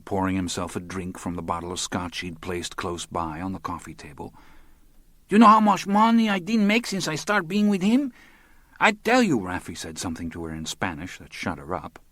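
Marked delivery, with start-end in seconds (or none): 5.3-9.4 put-on much higher, complaining young woman's voice; aggrieved young woman. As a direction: whine at him in broken English, wounded pride.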